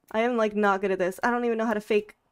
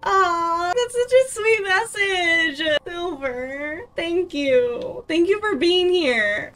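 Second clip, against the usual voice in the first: high, drawn-out, tearful thanks; touched cooing; 5 semitones higher, much slower than usual. Real, not acted. Streamer thanking a viewer for a sweet message, tearfully touched. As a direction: coo it as if moved to tears.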